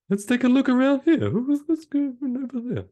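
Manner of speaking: high, put-on mock voice scanning the beach; a nosy lookout peering around, hammed up; periscope lookout. Playing a man scanning the beach like a periscope, in a high mock voice.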